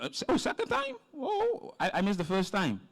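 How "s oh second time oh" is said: real surprise, voice leaping high; surprised. Real, not acted. Host learns the poet is back for a second time. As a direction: pitch jumps way up in surprise.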